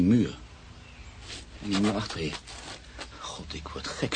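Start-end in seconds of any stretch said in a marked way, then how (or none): 3.2-4.1 panicked whisper. Acted. Panicked man sees the name everywhere.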